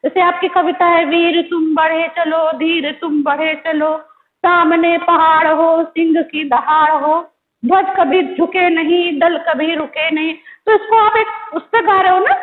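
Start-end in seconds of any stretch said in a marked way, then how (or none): 1.1-10.3 slow, measured chanting of a marching poem; rhythmic poem recital. Shown as a demonstration. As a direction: recite it in steady beats for the class. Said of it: "धीमे स्वर में पढ़ना है". (कविता को लय में धीरे-धीरे पढ़ना)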